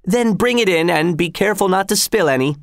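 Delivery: high, called-out order; calling through door. A narrator's reading. Executioner ordering his helper through the door, high call. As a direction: send the instruction to the next room.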